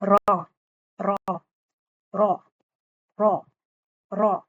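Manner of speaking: را with one light tap of the tongue; single roll raa. Shown as a demonstration. Teacher models the correct single-roll را. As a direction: say را rolling the r once only. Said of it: "رول ہو رہی ہے نا میری زبان".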